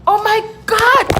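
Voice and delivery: very high, loud imitated astonishment; mock amazement. Locals hearing she came three months ago, mock amazed. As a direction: exclaim as if it's a miracle.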